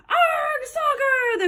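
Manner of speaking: very high, excited crew echo; crew yell. A narrator's reading. Crew copies the captain's soccer cry, yelling. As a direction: squeal it out as the whole crew. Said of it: "the crew yelled".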